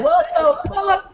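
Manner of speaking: high and slow, mock horror; stunned what the fuck. Real, not acted. Looking at the mess in his room, mock horrified.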